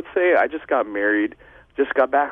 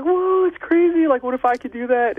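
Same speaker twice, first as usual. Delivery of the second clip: put-on amazed kid; 13 semitones higher, 3 dB louder than usual. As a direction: gasp it out like a child seeing magic. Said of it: "and you're like".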